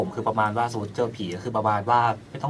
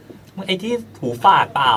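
high, loud, drawn-out doubting; incredulous retold reply; acted disbelief; 9 semitones higher, 7 dB louder, slower than usual. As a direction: question a friend, stretched out and high. Acted friend doubting in retold story.

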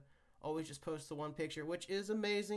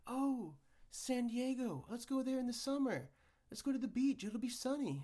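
tourist voice; 7 semitones higher than usual. Retold or imitated. Mocking tourist thinking.

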